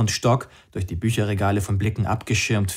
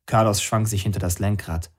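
ordinary delivery

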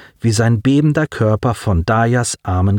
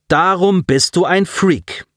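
slow, high, scornful jab; older boy insulting a classmate with contempt; contemptuous insult; 8 semitones higher than usual. Acted. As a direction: spit the insult at him. Alexander insults Colin, shoves him.

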